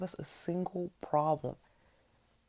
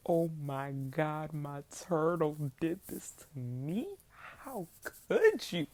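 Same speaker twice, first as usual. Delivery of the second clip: slow, drawn-out hurt whimper; a kid's teary "how could you", stretched slow; mock weepy disbelief; slower than usual. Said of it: "now I'm crying".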